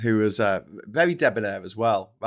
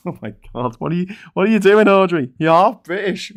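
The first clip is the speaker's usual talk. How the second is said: laughing disbelief; 9 semitones higher, 9 dB louder than usual. Real, not acted. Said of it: "exasperated laughing at Audrey".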